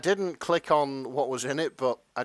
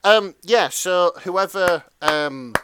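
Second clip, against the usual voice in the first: louder, higher call-out; loud mock call-out; 4 semitones higher, 8 dB louder than usual. Real, not acted. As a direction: call out the culprit. Calling out the author.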